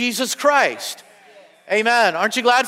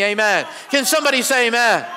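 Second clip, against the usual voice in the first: ordinary talk, nothing marked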